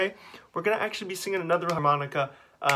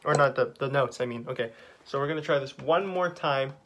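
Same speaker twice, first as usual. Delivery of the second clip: ordinary talk, nothing marked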